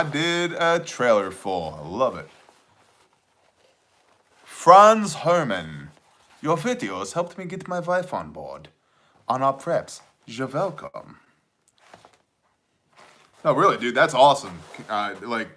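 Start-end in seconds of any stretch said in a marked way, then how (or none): 4.4-11.2 mock German accent. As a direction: read it like a hammed-up German. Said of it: "You have a southern accent".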